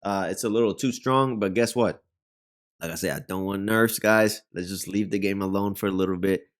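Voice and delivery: usual speaking voice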